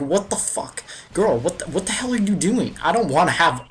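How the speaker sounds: indignant yell at the girl, reenacted; outraged protest. Boy retells girl's marshmallow trick.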